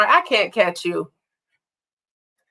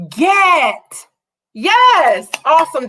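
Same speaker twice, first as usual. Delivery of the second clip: excited praise; 7 semitones higher, 3 dB louder, slower than usual. Real, not acted. Praising answer.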